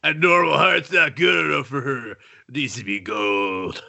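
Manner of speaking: put-on cartoon tough guy, slowing at the end; Assy McGee. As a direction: play an overwrought cartoon hero explaining himself. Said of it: "devolved into the Assy McGee".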